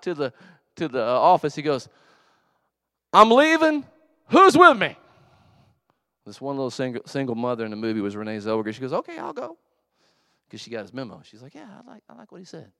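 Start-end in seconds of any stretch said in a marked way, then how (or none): 3.1-4.9 much higher, very loud call-out; imitated defiant shout. Jerry calls his office to follow him, loud defiant shout. 8.9-9.6 very high, small put-on voice; imitated meek woman. Single mother volunteers to follow Jerry, high meek voice. 11.6-12.7 very high, small put-on voice; imitated meek woman. Single mother volunteers to follow Jerry, high meek voice. Same woman agrees with the memo.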